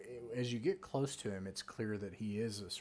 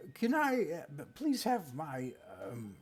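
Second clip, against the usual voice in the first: put-on frail old man; 5 semitones higher than usual. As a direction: play a nervous old bookkeeper lying about his books. GM voicing old man NPC.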